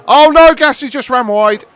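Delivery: loud cry of dismay, high and sharp; dismayed shout. Real, not acted. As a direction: yell it as your driver blunders. Car runs wide, dismayed outcry.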